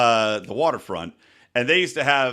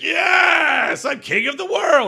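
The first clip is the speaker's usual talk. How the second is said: high, loud victory yell; triumphant shout; 5 dB louder, much slower than usual. Retold or imitated. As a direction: roar it out like you just won. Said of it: "I was like".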